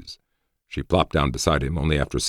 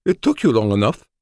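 high, loud, reproachful woman's voice; displeased woman; 8 semitones higher, 5 dB louder than usual. A narrator's reading. Woman unhappy at his delayed answer, displeased. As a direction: complain in a high-pitched character voice. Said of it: "She still wasn't happy".

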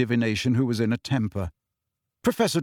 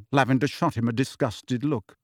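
usual speaking voice

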